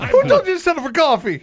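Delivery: teasing a friend who caved, incredulous. Real, not acted. Friend mocks his cheap settlement, incredulous and laughing.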